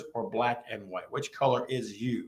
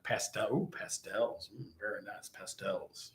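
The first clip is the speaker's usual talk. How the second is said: mock swooning viewer; 4 semitones lower, 11 dB quieter than usual. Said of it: "he says".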